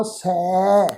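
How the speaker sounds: ਸੈ held long with the mouth wide open; open ai vowel. Shown as a demonstration. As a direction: open fully and draw out ਸੈ. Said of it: "ਪੂਰੀ ਤਰ੍ਹਾਂ ਖੋਲ੍ਹ ਕੇ ਉਚਾਰਨ". (ਸੈ ਨੂੰ ਮੂੰਹ ਖੋਲ੍ਹ ਕੇ ਲਮਕਾਓ)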